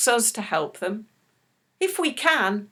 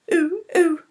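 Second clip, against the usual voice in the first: monkey ooh; 8 semitones higher, 3 dB louder than usual. Pretend. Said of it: "Shall we sing it?".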